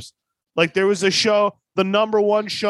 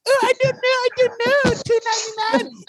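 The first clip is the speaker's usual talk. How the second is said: much higher caricature voice; mock Miss Swan voice. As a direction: squeak like a confused cartoon shopkeeper. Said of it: "He's like".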